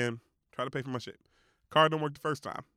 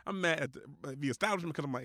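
usual speaking voice

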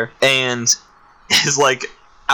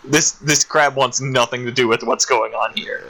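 ordinary delivery

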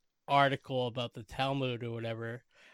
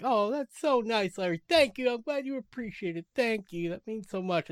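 mock sweet gratitude; 6 semitones higher, 4 dB louder than usual. Susie gushing thanks to Larry, mock sweet and higher. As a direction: gush like she's touched, a bit hammed-up. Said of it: "sweetness between the two of them".

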